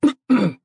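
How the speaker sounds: sudden startled cough; choked cough. Acted. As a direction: cough as if choking on air. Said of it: "猛地咳嗽了两声".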